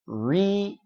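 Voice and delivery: "rear" split slowly into "ree" and "er"; heceleyerek gösterim. Shown as a demonstration. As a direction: sound out "rear" in two separate pieces, ree... er. Sounding the word out in parts.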